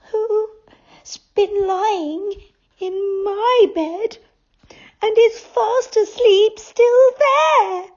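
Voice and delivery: high, slow little bear voice; a tiny bear's sleepy question; Baby Bear voice. A narrator's reading.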